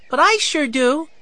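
put-on high-pitched eager little boy; small boy crying out, high; excited cry. A narrator's reading. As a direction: pipe up like a hungry six-year-old. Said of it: "Benny cried".

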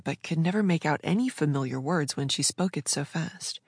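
plain reading voice

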